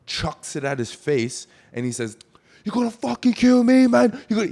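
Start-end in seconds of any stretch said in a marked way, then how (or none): 2.6-4.5 loud, high mock fury; fuming. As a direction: imitate a man screaming in a rage. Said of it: "he's fuming".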